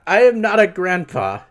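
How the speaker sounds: loud, high-pitched indignant retort; indignant protest. Real, not acted. Streamer rejects a chat nickname, loud and indignant.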